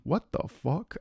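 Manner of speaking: usual speaking voice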